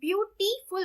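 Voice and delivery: Beautiful in three separate beats, ब्यू-टी-फुल; syllable by syllable. Shown as a demonstration. Teacher counting three syllables, beautiful split as ब्यू-टी-फुल. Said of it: "beauty के अंदर हमारे three syllable".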